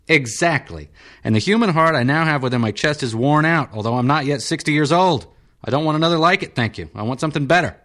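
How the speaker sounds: high, fast peevish patient voice; irritable senator. Acted. Senator insisting to the surgeon on metal, high peevish.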